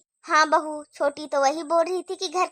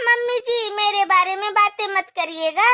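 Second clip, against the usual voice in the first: ordinary delivery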